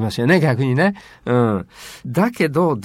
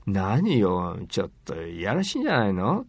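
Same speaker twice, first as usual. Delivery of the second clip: mock disapproval; 6 semitones lower, 5 dB quieter than usual. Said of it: "とこうね".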